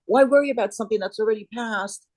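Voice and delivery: normal talking voice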